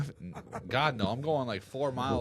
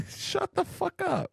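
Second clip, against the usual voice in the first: high mock yell. Real, not acted. Heated podcast argument.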